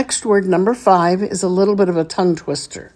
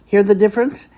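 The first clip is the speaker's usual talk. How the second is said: usual speaking voice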